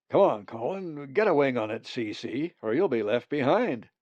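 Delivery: loud call back over his shoulder. Acted. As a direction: call him to hurry up and follow. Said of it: "called to him".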